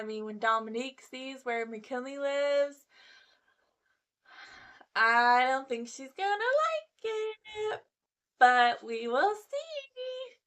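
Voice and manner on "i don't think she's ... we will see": teasing doubt. Real, not acted.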